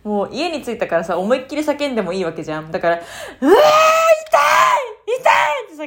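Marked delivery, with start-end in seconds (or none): 3.0-5.9 very high, loud cry of 痛い; pained shout. Retold or imitated. A woman reenacting screaming in pain at home. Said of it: "痛いって叫んだの".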